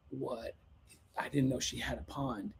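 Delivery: very soft, low murmur of disbelief; hushed, dumbfounded aside; stunned. Retold or imitated. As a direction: take it in under your breath. Stunned on seeing the pond.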